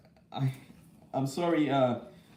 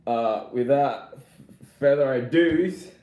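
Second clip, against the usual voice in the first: announcer build-up; 2 semitones higher, 6 dB louder, slower than usual. Real, not acted. Announcing the unboxing.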